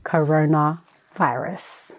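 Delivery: slow ominous. Acted. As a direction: drag the word out darkly. Slow answer to the shout.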